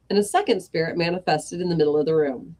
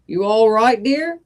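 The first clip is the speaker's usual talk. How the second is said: higher, louder game show host greeting; the Bruce; 5 semitones higher, 6 dB louder than usual. Acted. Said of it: "said the Bruce".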